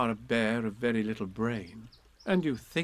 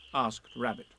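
usual speaking voice

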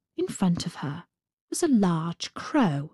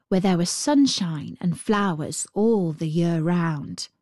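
ordinary delivery, nothing marked

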